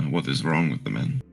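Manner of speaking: normal talking voice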